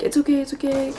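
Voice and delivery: lightly voiced mom calming her child; reassuring. Mom comforting her crying child, lightly voiced soothing. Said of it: "she's like".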